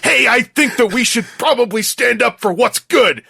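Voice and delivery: high, loud mock-heroic voice; good-guy Decepticon. Podcaster mocking reformed villains' voice change, goody-goody hero. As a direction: parody a villain suddenly turned noble. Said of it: "hear their voice get a bit deeper".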